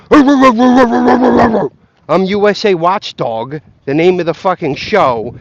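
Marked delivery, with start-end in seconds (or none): 0.1-3.6 slow, loud, drawn-out proud announcement; mock self-important watchdog; mock tough host. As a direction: puff it up like a big tough anchor. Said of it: "He goes from".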